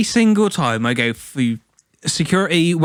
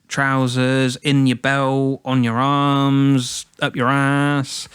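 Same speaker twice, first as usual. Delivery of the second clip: ordinary delivery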